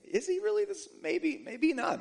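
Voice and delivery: high, hesitant wondering aloud; voiced second thoughts. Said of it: "they're beginning to rethink things".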